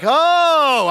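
big, high mock exclamation. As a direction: let out a loud, drawn-out "oh" of realization. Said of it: "I'm even louder than him this time".

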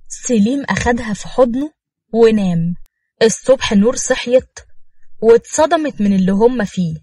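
ordinary delivery

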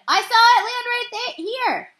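high, loud eager shout; first baseman shouting to teammates. A narrator's reading. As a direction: call out pointing at the puddle. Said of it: "the first baseman shouted".

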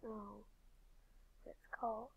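soft unvoiced whisper. Real, not acted. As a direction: trail off in a whisper. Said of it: "why are we whispering like that?".

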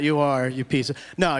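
ordinary delivery

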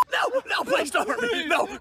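high-pitched panicked begging; acted terror. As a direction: plead frantically, hammed-up.

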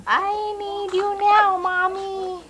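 put-on high, slow little baby voice; high pretend baby voice pleading for mommy; voicing the baby. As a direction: speak for the crying baby up high. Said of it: "That says".

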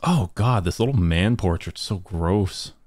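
usual speaking voice

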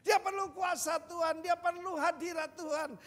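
climbing, loud preacher's build; preaching at full force. Real, not acted. Preacher urging the congregation, rising to a shout.

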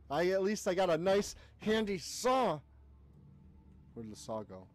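usual speaking voice

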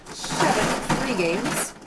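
seven free games stretched slowly, each word drawn out; slow, dragged-out prize call; slow drawn callout. Acted. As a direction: savour it like an announcement.

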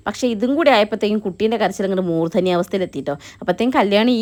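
ordinary talk, nothing marked

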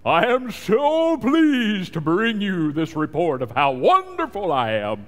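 mocking a showy, self-praising prayer; pompous mock prayer. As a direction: ham up a boastful prayer to God. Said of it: "they'll talk to you like this".

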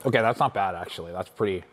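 tongue burning, mouth full of candy; eating sour candy. Real, not acted. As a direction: speak around sour candy. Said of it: "my tongue is on fire".

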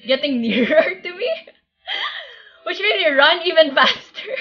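words broken by laughter; laughing while talking. Real, not acted. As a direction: laugh through the story. Laughing while retelling the chase.

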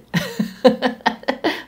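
high amused laugh. Real, not acted. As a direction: laugh at your own acting. Laughs after acting mad.

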